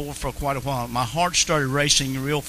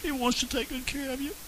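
much softer, gentle and reassuring; soft tender repeat; 7 semitones higher, 8 dB quieter than usual. Real, not acted. Preacher tenderly reassuring listeners that Jesus cares, soft. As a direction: hush down and reassure them.

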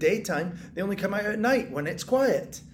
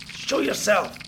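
ordinary delivery

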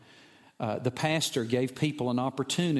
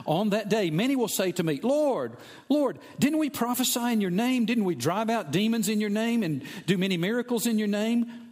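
impassioned preaching; 8 semitones higher than usual. Acted. Preacher quoting pleading crowd at judgment.

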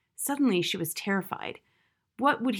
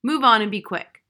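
louder annoyed command from the guard rat; a touch of an irritated guard rat; 3 semitones higher, 8 dB louder than usual. A narrator's reading. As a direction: snap the order at her. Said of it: "puzzled and annoyed".

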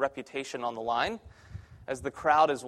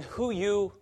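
higher, bare broken-English question; 4 semitones higher than usual. Retold or imitated. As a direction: imitate a beginner's very broken English. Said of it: "if it's very broken".

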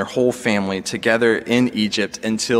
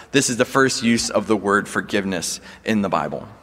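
ordinary talk, nothing marked

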